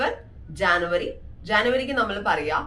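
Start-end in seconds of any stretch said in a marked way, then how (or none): none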